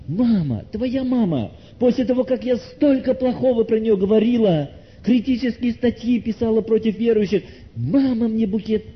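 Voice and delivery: high, incredulous outpouring on the edge of tears; overwhelmed headmistress. Acted. Headmistress learns the believer's mother sent flowers, overwhelmed. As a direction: exclaim it high, stung with disbelief. Headmistress overcome on receiving bouquet.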